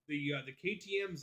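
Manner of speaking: ordinary talk, nothing marked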